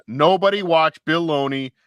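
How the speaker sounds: loud, high, drawn-out mock decree; mock outrage. Host warning viewers off a rival's cooking show, mock outrage. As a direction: issue an indignant public warning, loud and slow.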